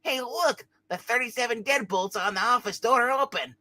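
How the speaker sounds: high-pitched little figure, lightly voiced; a hint of a high cartoon voice; excited character voice. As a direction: pipe up high as if spotting something. Figure spots the open locks.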